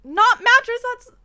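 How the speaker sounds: high, loud, half-laughing objection; loud laughing protest. Real, not acted. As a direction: shriek a protest.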